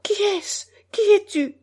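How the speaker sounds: high, frightened cry, held down; a woman caught off guard crying out; startled muffled cry. A narrator's reading. Seamstress startled by the boy in her room, high frightened cry. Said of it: "se mit à crier d'une voix étouffée".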